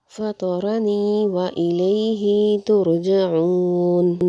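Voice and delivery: slow, melodic tajweed recitation of the verses; Quran recitation. Shown as a demonstration. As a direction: chant each verse slowly and melodically. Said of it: "یہ میں سورہ یاسین کی آیت پڑھتی ہوں". (سورہ یاسین کی آہستہ، سریلی تلاوت)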